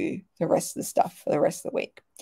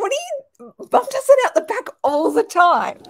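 much higher, squeaky put-on voice of disbelief; incredulous husband; 13 semitones higher, 6 dB louder than usual. Chris baffled at the crystal confession, squeaky mock disbelief. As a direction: play him as baffled and a bit silly. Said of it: "And Chris is like".

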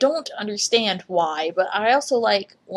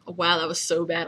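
normal talking voice

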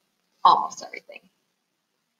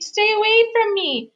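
very high mouse squeal; high-pitched frantic mouse; squeaked warning; 13 semitones higher, 4 dB louder than usual. A narrator's reading. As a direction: warn a friend off in a squeak. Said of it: "Scuttle squeaked".

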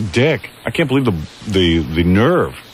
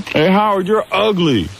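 high-pitched imitation of a heckler; mock heckler's yell; 11 semitones higher, slower than usual. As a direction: mimic a stranger calling out an insult. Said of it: "some guy yells out".

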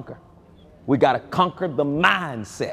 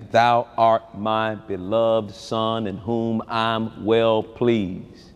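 slowed, weighty voice of heaven; slow solemn; 5 semitones lower, much slower than usual. Acted. Preacher reads God's voice from heaven, slow and solemn. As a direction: draw each word out.

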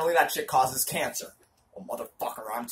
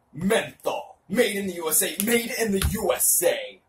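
raised, louder grand proclamation; dramatic proclamation; 5 semitones higher, 4 dB louder than usual. Shown as a demonstration. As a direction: proclaim it like a stage hero. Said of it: "Dramatic reading".

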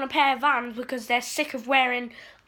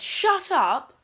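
annoyed snap; 6 semitones higher, 3 dB louder than usual. Retold or imitated. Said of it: "and I said".

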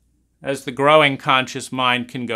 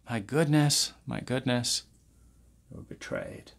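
fading to whisper; 9 dB quieter than usual. Acted.

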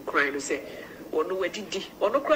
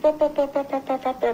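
rapid, higher-pitched run of "pa"; imitated heartbreak sound; 6 semitones higher than usual. Caller describing a breaking heart, imitated rapid sound. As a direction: rattle off "pa pa pa" as a sound effect.

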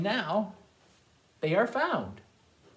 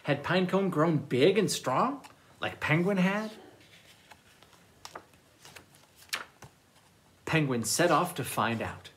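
put-on lively read-aloud for children; storytime reading. A narrator's reading. Librarian reads picture books aloud.

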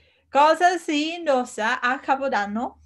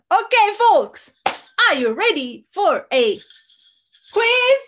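excited host; 7 semitones higher, 5 dB louder than usual. Acted. Hosting a live quiz.

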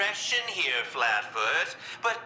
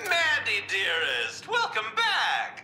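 theatrical greeting; 9 semitones higher, slower than usual. Acted. Joker greets interrogator Mandy theatrically.

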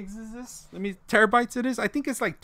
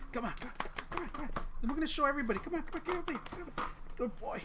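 talking to a dog; 7 semitones higher, 11 dB quieter, much faster than usual. Real, not acted. Coaxing his dog.

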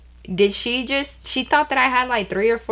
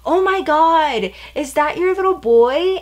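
high, louder, slowed gush over a photo; gushing coworker; 8 semitones higher, 4 dB louder than usual. Retold or imitated. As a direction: coo like she's seen a baby.

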